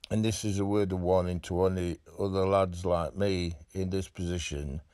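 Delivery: usual speaking voice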